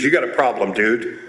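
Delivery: low-pitched blunt confrontation; confronting buddy. Acted. Reformed buddy confronting the speaker at his door, low and blunt. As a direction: drop low and level the charge.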